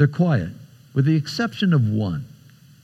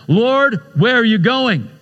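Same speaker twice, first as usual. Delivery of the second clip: high, raised-voice question; speaking out loudly; 9 semitones higher, 3 dB louder than usual. Acted. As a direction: call it out for everyone at the table. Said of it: "speaks out loudly".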